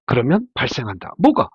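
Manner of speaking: ordinary talk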